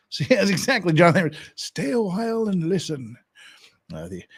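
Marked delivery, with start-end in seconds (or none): 1.5-3.2 put-on higher old-sage voice; higher pitched, kindly old storyteller; Deckard Cain impression. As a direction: invite them in like a weary old loremaster. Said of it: "Deckard Cain sorta had this kind of a voice".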